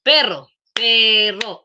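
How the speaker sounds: "perro, perro" said slowly, beat by beat; syllables split slow. Shown as a demonstration. Teacher showing the class how to split a word, slowly. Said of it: "así voy separando las sílabas".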